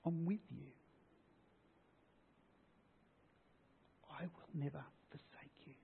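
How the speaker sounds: God softly reassuring a hurting listener; God's gentle voice. Acted. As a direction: speak as a comforting God, hushed. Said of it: "He says".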